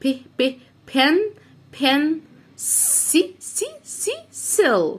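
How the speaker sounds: short separate ب, then "بن", then hissed سي up to "سيل"; sounding out. Shown as a demonstration. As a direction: break "بنسل" into isolated pieces. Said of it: "قول معايا".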